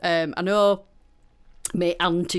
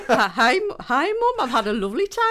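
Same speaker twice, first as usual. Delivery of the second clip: higher, louder Middlesbrough lilt; picked-up Middlesbrough accent; 5 semitones higher, 4 dB louder than usual. Retold or imitated. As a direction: imitate coming home with a Middlesbrough accent. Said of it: "everybody else around spoke like that".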